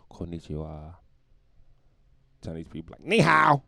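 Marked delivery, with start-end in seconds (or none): 0.1-1.0 lower, softer mock hush; soft Japanese greeting. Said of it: "Japanese people can whisper". 3.1-3.6 high, loud mock bark; failed whisper. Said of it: "I don't think Chinese people can whisper".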